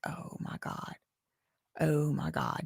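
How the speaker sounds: breathless whispered gasp of disbelief; shocked whisper. Retold or imitated. Imitating Jillian frozen in shock, whispering. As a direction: whisper it like you can't believe your eyes. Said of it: "She's like".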